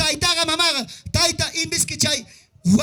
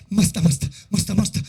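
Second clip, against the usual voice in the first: the devil's voice; 6 semitones lower than usual. Retold or imitated. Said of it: "Demonio ñata, que me dice".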